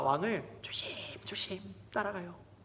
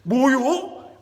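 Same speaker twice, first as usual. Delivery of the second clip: loud, high, shocked demand; explosive angry question; outraged king; 7 semitones higher, 9 dB louder, much slower than usual. Acted. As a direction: burst out in royal outrage. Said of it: "왕이 화가 단단히 났어요".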